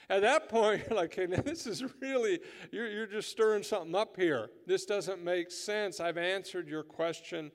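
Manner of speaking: loud, high, fervent preaching; rising revival-preacher fervour; preaching at full force. Acted. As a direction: preach it out to the back row. Impassioned retelling of Peter scene.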